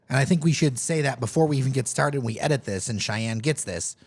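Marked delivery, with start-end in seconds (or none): none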